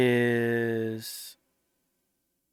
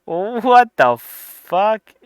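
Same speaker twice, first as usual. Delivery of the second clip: laughing disbelief; 7 semitones higher, 4 dB louder than usual. Real, not acted. Laughing at the Pakistan line.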